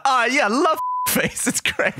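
slow, high, laughing casual reply; casual out of character. Retold or imitated. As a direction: drop the act, chuckle it out. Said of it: "snap out of character and be like".

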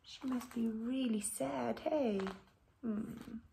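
ordinary delivery, nothing marked

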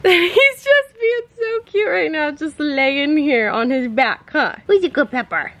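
high, slow gushing puppy talk; baby voice. Acted. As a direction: coo over the puppy. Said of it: "trying to not use my baby voice".

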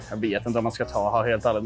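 normal talking voice

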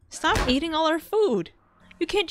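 a mock-angry outburst; exasperated yell. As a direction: yell at the food thief. Yelling at the ghost.

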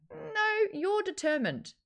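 high-pitched mock pushback; drawn-out high "No", correcting a customer; mock correction. Voices reply to entitled customer.

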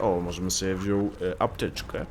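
normal talking voice

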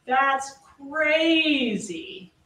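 That's crazy stretched very slowly, each word long; drawn-out mimic. Prosecutor mimicking the defendant's dragged-out reply, very slow. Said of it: "He says it like that".

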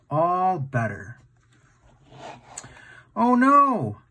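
ordinary delivery, nothing marked

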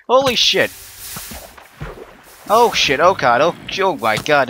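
very high, very loud startled yelling; panicked screams. Real, not acted. Gamer caught off guard by mobs, panicked screaming. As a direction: shriek as the mob jumps you. Mob swarms him in game.

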